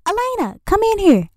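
high calling-out voice, mom summoning her daughter. Retold or imitated. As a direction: call her in like a mom from the next room. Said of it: "She's like".

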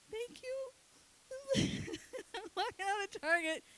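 very high, mock tearful squeak; hammed-up crying as you speak. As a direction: imitate an overwhelmed mother squeaking through tears. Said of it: "I'm crying".

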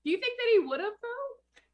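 disbelieving high question; can't believe it; incredulous. Real, not acted.

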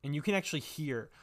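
usual speaking voice

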